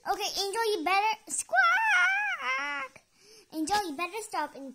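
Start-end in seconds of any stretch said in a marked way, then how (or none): 0.8-3.0 slow warning breaking into a high squawk; scolding cut by squawk. Acted. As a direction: start to scold, then screech like a bird. Speaker cut off by bird.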